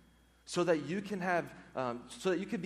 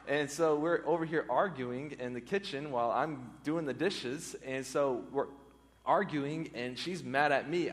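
normal talking voice

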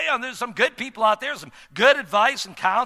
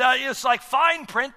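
normal talking voice

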